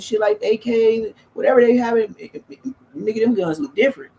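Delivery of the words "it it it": stumbling over repeated words; real stutter, repeating the first word. Real, not acted. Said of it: "I'm stuttering".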